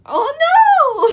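high, loud, drawn-out mock wail; acted squeal of dismay; mock alarm. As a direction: wail it like a hammed-up panic. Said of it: "And she's like".

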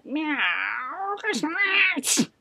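mewing cat, rising to a high cry. A narrator's reading. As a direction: meow it out like a cat. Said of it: "Simpkin said".

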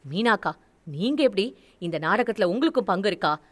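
ordinary delivery

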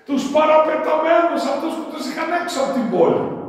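high, loud call for the outcasts. Real, not acted. Preacher naming the outcasts, loud and ringing. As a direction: fling it wide with passion.

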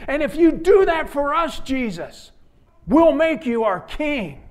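the crowd pressing Jesus for favors. Acted. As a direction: bargain like an eager crowd. Crowd voiced bargaining with Jesus.

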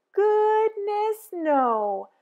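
high, loud playful exclamation; emphatic cheerful denial; goodness no. A narrator's reading. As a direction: cheerfully brush off the idea. Answering did Pete cry.

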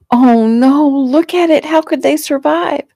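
high, horrified cry; distraught. A narrator's reading. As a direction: cry out at a terrible sight. Girl spots wrecked family car.

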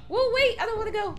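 high nervous protest. Real, not acted. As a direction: balk at the jump. Scared of the jump.

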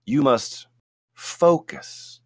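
a hint of a gentle mystical Yeti; kindly voice of the Yeti. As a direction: speak slowly and kindly as a wise Yeti. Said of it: "said the kindly voice of the mystical Yeti".

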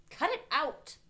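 high, loud sharp order; snapped. A narrator's reading. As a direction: snap at him to stop. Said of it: "I snapped".

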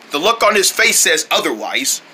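ordinary delivery

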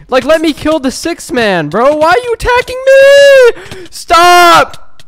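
slow, high, drawn-out yelling; outraged scream. Real, not acted. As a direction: build from loud protest to a scream. Attacked by others mid-fight.

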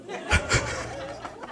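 soft breathy laugh; quiet giggle after the quote; burst of laughter. Real, not acted.

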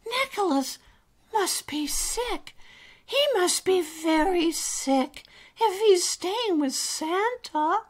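high, anxious little voice; worried little character. Acted. Alistair frets that his friend is staying with Santa, worried. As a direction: pitch it high as the worry grows.

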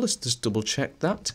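ordinary talk

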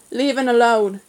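loud, surly Cornish growl; gruff, hostile order; growled warning. A narrator's reading. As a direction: warn them off with a growl. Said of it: "he growled".

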